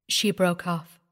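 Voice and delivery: plain reading voice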